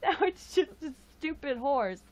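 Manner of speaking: mock a little girl's heartbroken grumble; upset child quote. Retelling the niece's upset over the unicorn horn, a mock sulky whine. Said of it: "she was like".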